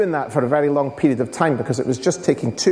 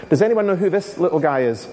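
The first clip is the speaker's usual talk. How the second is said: usual speaking voice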